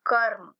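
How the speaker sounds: कर्म, र without a vowel; half r pronunciation. Shown as a demonstration. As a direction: say कर्म, र bare before म. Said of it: "र को बिना अ के बोलना है".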